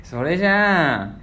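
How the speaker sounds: mock gal voice, drawled; gal imitation. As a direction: drag the ending like a gal. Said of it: "そんな話し方してないじゃん".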